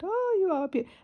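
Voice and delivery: put-on high, sing-song baby talk; settling the traumatised pigeon in its box, high baby talk; pet talk. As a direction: soothe a frightened little bird. Admiring the pigeon.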